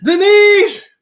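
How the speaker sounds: high, very loud, drawn-out holler; big hailing call; shouted name. Real, not acted. Calling out to arriving viewer.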